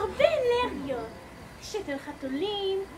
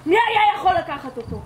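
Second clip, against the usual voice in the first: much louder, alarmed; loud alarmed question; 10 dB louder than usual. Acted. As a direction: demand it loudly. Officer alarmed about missing gun.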